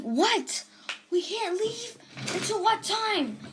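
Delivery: high, slow, stunned question; can't believe what they just heard; shocked disbelief. Retold or imitated. Kids shocked they can't leave.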